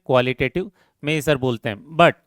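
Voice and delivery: usual speaking voice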